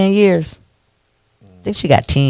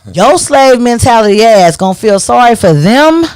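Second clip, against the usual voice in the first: angry rant; 6 semitones higher, 5 dB louder than usual. Real, not acted. Ranting at sympathizers.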